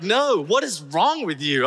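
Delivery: slow, high, incredulous telling-off; exasperated scolding. Retold or imitated. Jessica in the car reacting to his confession, exasperated.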